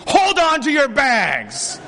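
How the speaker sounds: high, slow, loud warning; urgent shout. Retold or imitated. Warning his group at the airport, shouting. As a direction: call out over the crowd. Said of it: "And I'm saying to my group".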